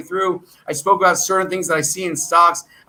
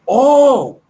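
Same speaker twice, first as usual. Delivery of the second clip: sudden realization; 7 semitones higher, 7 dB louder than usual. Real, not acted. Sudden business idea strikes him.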